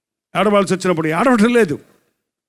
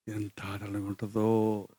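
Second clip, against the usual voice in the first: very soft, low and slow; hushed relief; 8 semitones lower, 12 dB quieter, slower than usual. Acted. Preacher imagining the comforted heart's relief, hushed relief. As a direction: let it sink to a low, savouring hush.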